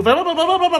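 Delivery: exaggerated run-together gibberish; mock fast talk. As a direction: imitate someone gabbling too fast to follow. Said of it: "You gotta talk slowly".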